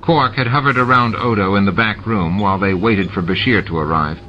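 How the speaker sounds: usual speaking voice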